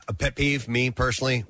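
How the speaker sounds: ordinary talk, nothing marked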